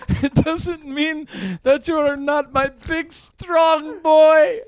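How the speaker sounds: mock Japanese accent, rising insistence; mock Japanese mother. Mom insists on her boy, building mock Japanese accent. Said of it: "I'm the Japanese part of your family".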